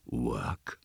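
low, soft rising question; goblin's query. A narrator's reading. Goblin doubts the sexton's excuse, low voice.

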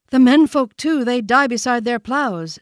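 put-on high, loud young deer voice; young sambar deer. A narrator's reading. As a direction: report it urgently, pitched high. Young deer reports deaths.

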